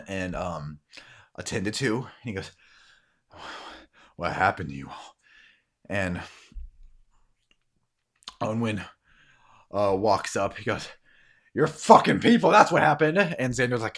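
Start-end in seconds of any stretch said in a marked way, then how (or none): 4.2-5.1 low-pitched, measured question; grave question. Acted. Man questions wounded arrivals. 11.5-13.2 loud, high furious outburst; raised voice, lashing out; angry accusation. Acted. Onwin angrily accuses the man.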